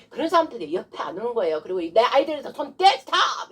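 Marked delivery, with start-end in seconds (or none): 2.5-3.5 high, loud demonstrated order; sharp exorcist's shout; forceful command. Shown as a demonstration. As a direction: shout the devil off the children. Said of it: "이러면 돼요".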